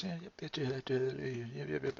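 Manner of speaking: soft, lazy agreeing voice; easygoing right brain. Pretend. Right brain happily agreeing to anything, soft lazy voice. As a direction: nod along softly, half asleep.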